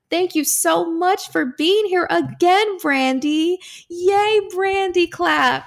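high, slow, gushing thanks; drawn-out, celebratory high pitch; cheery sign-off. Real, not acted. Host thanks and cheers the guest.

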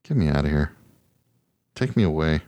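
ordinary talk

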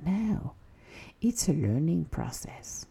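soft whispered reassurance; reassuring whisper. Retold or imitated. Answering your own doubt, soft reassuring whisper. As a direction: hush and reassure yourself. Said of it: "And you're like".

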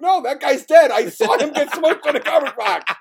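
incredulous protest. Retold or imitated. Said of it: "And I'm like".

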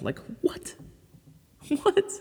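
breathy, high and incredulous; can't believe what he just said; incredulous surprise. Real, not acted. Host marvelling to listeners at Mars water, incredulous.

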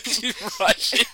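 put-on high, slow Russian-accented echo; mock Russian accent. Echoing friend's joke about the dog, mock Russian accent. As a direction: draw it out with a hammed-up Russian accent.

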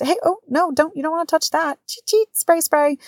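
high, singsong mock-fretful voice; fussing mom. Mom fending kids off germs, high and fussing. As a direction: pitch it way up, hurrying the kids off germs.

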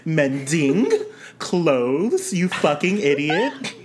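slow "Mending... clothes", each word swooping up, then laughing; slow mock repeat. Host mocks being talked down to, slow and condescending. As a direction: spell out "mending clothes" slowly as a joking insult. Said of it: "repeat it for a third time".

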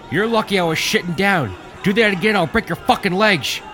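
high, loud threatening shout; furious yell. A narrator's reading. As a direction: threaten him while held back. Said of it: "Willie yelled".

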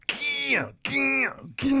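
high-pitched sputtering gah noises; mock old man sputter. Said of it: "and he went".

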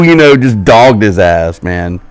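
ordinary talk, nothing marked

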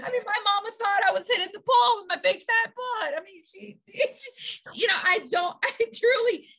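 laughing through the words, voice high; real helpless laughter breaking into the lines. Real, not acted. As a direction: talk through tears of laughter. Laughing at her own memory.

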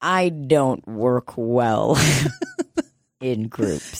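slow, low, spaced-out words; halting admission. Retold or imitated. Client explaining to clinic staff, slow reluctant admission. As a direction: drag the confession out. Retold confession to therapy staff.

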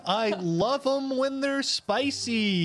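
cheesy charmer relishing her sass; flirty bird-man. Acted. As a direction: savour the words like a flirt. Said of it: "Chet goes".

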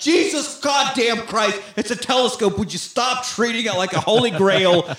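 a hammed-up grumpy rant; exasperated Bruder. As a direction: lose patience over a telescope. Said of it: "Bruder's just like".

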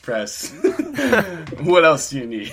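loud, high, cracking up; helpless laughter breaking into the words; laughing. Pretend. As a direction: push the joke out through laughter. Cracking up at bench press joke.

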